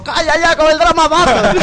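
neighbors yelling. Retold or imitated. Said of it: "y los vecinos de al lado".

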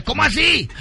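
high startled question; a priest caught off guard; priest's surprise. Acted. Confessing boy to priest, high surprise.

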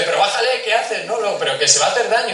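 high, hurried mock alarm from the wife; imitating wife. Husband imitates his wife at the park, high and alarmed. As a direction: play the worried wife stopping him, a bit hammed-up. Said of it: "Que viene de otro lado y dice".

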